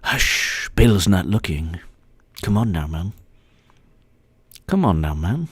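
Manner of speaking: low hammed-up old statesman; mock old politician. Same character whispering.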